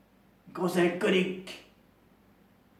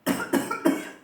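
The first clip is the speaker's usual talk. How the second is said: smoker's cough. Acted. Chain smoker character coughing.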